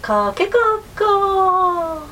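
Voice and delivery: high, slow, drawn-out rooster crow; proud rooster crow. A narrator's reading. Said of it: "喜んでもう一度歌いました". (喜んで高くゆっくり鳴くおんどり)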